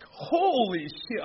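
high, slow shocked exclamation. Retold or imitated. Reading that the nose never stops growing, high shocked exclaim. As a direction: let the shock hit. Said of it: "freaking out".

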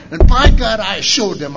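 imitating a proud woman; triumphant exclaim. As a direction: declare it proudly.

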